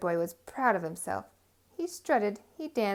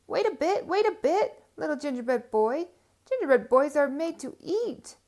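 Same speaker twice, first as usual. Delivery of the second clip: high, loud call to stop; 5 semitones higher, 7 dB louder than usual. Acted. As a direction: call after him. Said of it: "they called".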